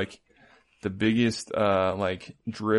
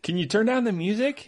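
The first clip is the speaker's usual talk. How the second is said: whiny housemate; 11 semitones higher than usual. Retold or imitated. Voicing reality show housemate.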